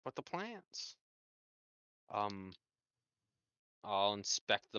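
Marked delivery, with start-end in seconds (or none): 0.0-1.0 high, squeaky mock whine on plants; a teasing high-pitched echo; mock whiny echo. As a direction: parrot it back in a silly high voice. Mocking echo of GM's word.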